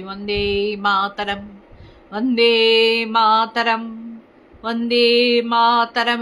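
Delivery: each syllable drawn out slowly; slow it down and lean on every sound; slow careful recital. Shown as a demonstration. Showing how the teacher taught Bande Mataram, slow and weighty. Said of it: "ধীরে ধীরে উচ্চারণ করতে হবে".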